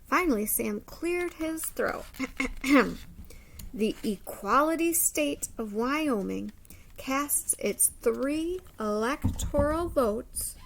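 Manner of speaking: expressive storybook read-aloud; lively picture-book narration; reading to children. Acted. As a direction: read it like story time for a class.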